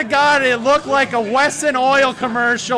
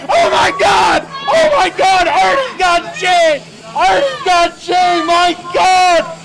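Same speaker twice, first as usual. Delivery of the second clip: loud, higher hype yells; excited yelling; 4 semitones higher, 4 dB louder than usual. Real, not acted. Commentator erupting as Artie gets Jay, loud yells. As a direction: yell it like the crowd can hear.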